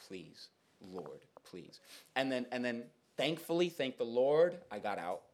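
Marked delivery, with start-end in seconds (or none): 0.1-1.8 low, very soft little prayer; pleading under breath. Retold or imitated. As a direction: beg quietly, as if to yourself. Silent plea while being followed.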